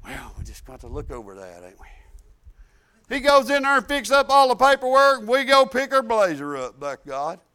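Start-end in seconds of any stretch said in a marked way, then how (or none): 0.0-3.1 much softer, low murmur; sheepish manager mutter; hushed aside. Retold or imitated. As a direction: concede it under the breath. Manager quietly conceding.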